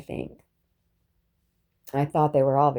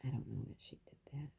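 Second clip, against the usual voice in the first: whispered aside; 3 semitones lower, 20 dB quieter, faster than usual. Real, not acted. Hushed aside about her mother.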